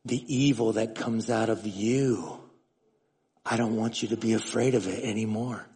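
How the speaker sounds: a hint of God speaking gently; God's voice. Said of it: "the Lord spoke to me and he goes".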